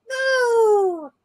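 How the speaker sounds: very high drawn-out squeaky no; high-pitched disappointed creature; high kitty voice. Retold or imitated. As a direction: wail no like a tiny kitty. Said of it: "It's like".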